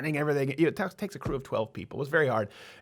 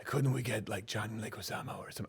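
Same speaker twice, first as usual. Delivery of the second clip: soft, low-energy celebrity impression; Steven Seagal; 3 semitones lower, 9 dB quieter than usual. Host mimicking an action star on casting, soft impression. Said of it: "doing the Steven Seagal voice".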